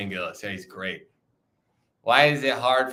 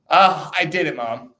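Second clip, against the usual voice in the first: higher, louder mock boast to Mom; mock triumph; 3 semitones higher, 7 dB louder than usual. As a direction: playfully cry out to Mom, ending in a laugh.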